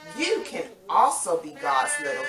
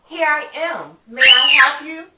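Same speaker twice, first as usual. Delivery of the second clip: put-on higher, louder eager boy; little Samuel running to Eli, eager and loud; 7 semitones higher, 13 dB louder than usual. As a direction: answer brightly like a keen little boy. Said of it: "Samuel said".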